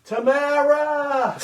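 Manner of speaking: drawn-out high happy call of her name; excited greeting. Real, not acted. Host warmly greeting a viewer from Tennessee, drawn-out and high. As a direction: sing out the name with delight.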